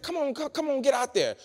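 high, loud cartoonish urging; mock pushy friend. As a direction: egg them on in a high voice. Mimics friends urging someone out.

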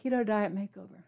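soft showy title read into a pretend mic; hushed mock presenter voice; pretend microphone announcer. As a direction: hold a lipstick like a mic and announce it softly. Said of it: "Can I pretend this is lipstick?".

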